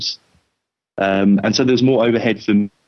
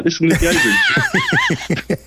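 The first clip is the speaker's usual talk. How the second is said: imitating; slower than usual. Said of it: "You're like".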